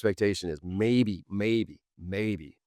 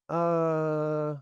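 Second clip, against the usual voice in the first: mock stunned uh; 8 semitones higher than usual. Pretends to be stunned.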